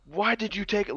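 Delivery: high-pitched imitation of a singer's scream; imitated scream. Recalling an alternate mix to bandmate fans, imitated scream. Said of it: "that scream was".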